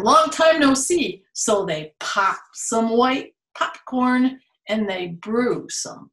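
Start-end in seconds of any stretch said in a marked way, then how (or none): 0.0-1.2 hare greeting, louder. Acted. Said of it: "says Hare".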